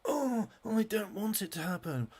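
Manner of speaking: high, put-on mocking voice of a fan pretending to care; high sarcastic whine mocking a fan. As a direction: sneer it in a high whiny voice. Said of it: "turns around here and goes".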